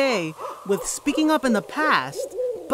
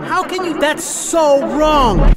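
louder, so wrong stretched slow and hard; firmer retort; 3 semitones higher, 7 dB louder, slower than usual. Retold or imitated. Boy rehearsing his comeback to the heckler, louder and firm. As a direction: finally say it like you mean it. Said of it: "I wanted to say so much".

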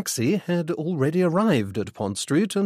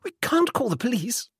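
high, anxious insistence; alarmed insistence; 12 semitones higher than usual. A narrator's reading. Mother refuses her son's suggestion, alarmed.

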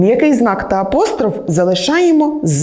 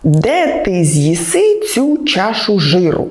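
slow mnemonic; slower than usual. Shown as a demonstration. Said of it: "Пригадайте правило дев'ятки".

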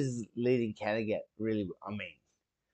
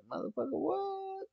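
much higher, stunned squeaky curse; imitating Ivor; 7 dB quieter than usual. Imitating Ivor reacting to the carnage, high stunned curse. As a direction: do the kid's shocked what. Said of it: "Ivor's there just going".

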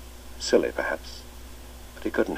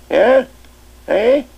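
put-on much higher and louder, playful old schoolmaster; twinkling old master; 13 dB louder than usual. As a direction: cap their joke with a merry invitation to tea. Chips prompting.

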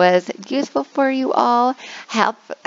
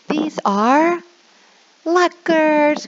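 higher, bright reveal of the word; teacher reveal; 8 semitones higher, much slower than usual. Shown as a demonstration. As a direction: announce it cheerfully to kids. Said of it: "click very quickly".

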